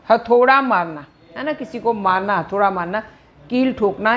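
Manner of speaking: ordinary delivery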